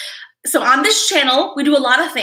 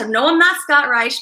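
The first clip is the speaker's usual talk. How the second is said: usual speaking voice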